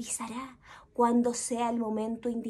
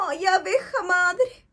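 higher whining plea; whiny bee; 7 semitones higher, 5 dB louder than usual. Acted. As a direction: whine to the mother bee. Sad cleaner bee complains.

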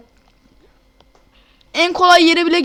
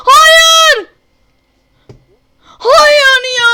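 loud high despairing no; anguished shout; 10 semitones higher, 9 dB louder, much slower than usual. Real, not acted. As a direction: wail it in real despair. Character slips, loses progress.

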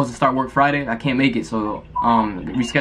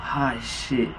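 ordinary delivery

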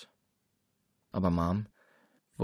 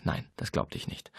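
whispered denial; 7 dB quieter than usual. A narrator's reading. Inner denial, unvoiced.

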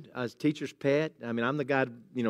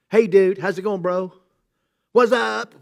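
louder, higher mock-cocky greeting to God; mock casual greeting; 3 semitones higher, 4 dB louder, slower than usual. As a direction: toss it off like a flippant buddy. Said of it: "we need to be praying like that".

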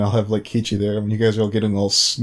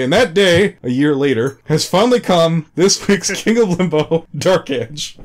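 raised-pitch showman announcement; grand guest introduction; 6 semitones higher, 3 dB louder than usual. Acted. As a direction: build up the guest's arrival like an announcer.

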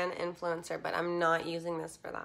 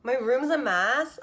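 surprised; 4 semitones higher, 10 dB louder than usual. Real, not acted. Reacts to viewer comment.

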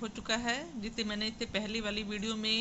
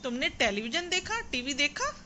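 higher-pitched question, rising on "देखा"; questioning tune; 5 semitones higher than usual. Acted. Teacher questions pupil about watching TV, questioning, "देखा" rising. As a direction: ask it higher, lifting the final "देखा". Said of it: "यानी question किया जा रहा है".

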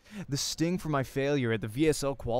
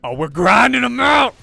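loud, much higher, triumphant yell; hyped cheer; 9 semitones higher, 14 dB louder than usual. Acted. Narrator cheering the grind of practice matches, full shout. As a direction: shout it like a hype man.

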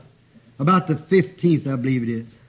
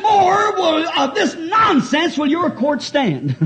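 high, slow courtroom bellow; retold indignant shout; 11 semitones higher, 6 dB louder, much slower than usual. Old attorney protesting nonsense to the judge, shouting. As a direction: act out an attorney's drawn-out outcry.

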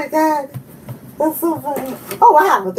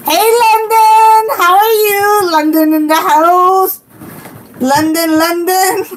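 excited greeting; 5 semitones higher than usual. Real, not acted. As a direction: call out a welcome in giddy singsong. Calls greeting to arriving viewer.

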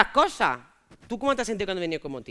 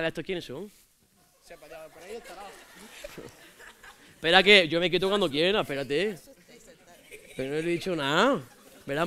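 put-on swaggering street lad; casual macho slang voice; Antonio; 5 semitones lower than usual. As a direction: play a cocky local guy chatting up strangers. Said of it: "cuando he venido como Antonio".

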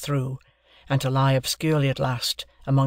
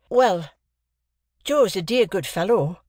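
put-on earnest young man voice; 6 semitones higher than usual. Young Pip's quoted voice.

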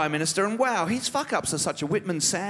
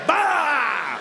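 a sudden high shout for a lizard's tongue flick; sudden lizard bark; 9 semitones higher than usual. Pretend. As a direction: flick it out with a barked "Bah!".

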